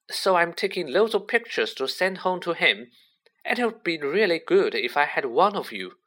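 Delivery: high, breathless-with-wonder fan chatter; excited. A narrator's reading. Colin telling Harry about Hogwarts, excitedly. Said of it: "great shuddering breath of excitement".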